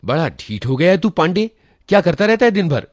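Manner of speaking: loud, high, fast angry scolding; boss scolding. A narrator's reading. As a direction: tear into the lazy clerk. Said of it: "इस डांट के बाद".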